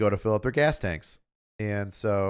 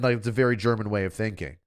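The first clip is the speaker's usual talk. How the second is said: ordinary delivery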